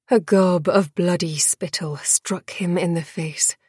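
ordinary delivery

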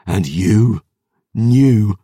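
rising furious accusation; angry accusation. Acted. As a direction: hit knew hard in anger. Brother turns in fury.

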